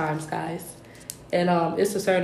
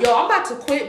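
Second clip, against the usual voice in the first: higher-pitched, worked-up retelling; retold heated outburst; 6 semitones higher, faster than usual. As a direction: vent like you're ready to walk out. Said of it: "I was so heated".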